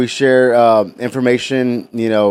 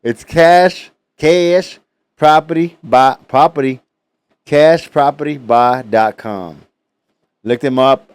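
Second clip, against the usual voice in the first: put-on loud, higher, drawn-out country accent on cash; mock country accent, louder on cash and property; hammed-up country accent; 3 semitones higher, 4 dB louder, slower than usual. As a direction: ham up a Southern country twang for the sponsor. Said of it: "your best country accent".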